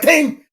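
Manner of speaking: single high ringing ding; imitated plate ding. Shooter acting out the last plate going down, imitated ding. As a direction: mimic one shot hitting steel.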